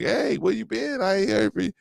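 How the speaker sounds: mimicking her casual hello; girlfriend's voice.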